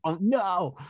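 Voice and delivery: high, mock-alarmed little oh no; a playful yelp of dread; mock dismay. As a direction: pretend to panic as the lock comes on. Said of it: "I'm like".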